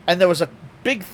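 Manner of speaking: normal talking voice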